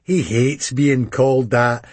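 ordinary delivery

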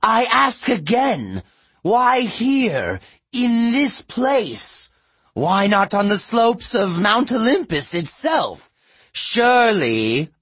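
very high, insistent daughter challenging her father. A narrator's reading. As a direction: plead in a high, reedy voice. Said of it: "Her voice was higher and reedier".

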